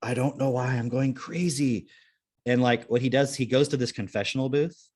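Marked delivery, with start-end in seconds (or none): 0.0-1.9 lightly acted, slow bewildered confession; slow, mildly mock distress; distressed film character. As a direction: voice a troubled man, drawn out. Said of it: "And he's like".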